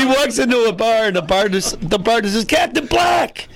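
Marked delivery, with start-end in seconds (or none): 2.4-3.5 slow, drawn-out called greeting, high; shouted greeting. Acted. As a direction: call out the name across the bar. Bar greets pirate loudly.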